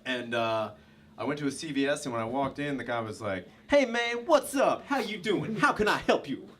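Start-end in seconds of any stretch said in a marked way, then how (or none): 3.7-6.5 high-pitched, hyped-up greeting; hammed-up eager store clerk; over-caffeinated clerk. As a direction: greet like you're buzzing on energy drink. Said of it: "he was drinking way too much Rockstar energy drink".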